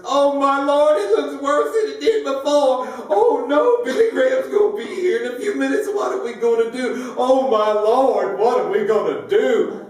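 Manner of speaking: put-on high, loud frantic little old lady; old church lady in a high flustered panic; panicky old lady. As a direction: squeal it out like a fretting church lady.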